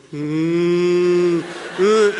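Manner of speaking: loud drawn-out hum; mock rocking hum. Roommate rocking after singing, loud drawn-out humming. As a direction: hum and moan while rocking.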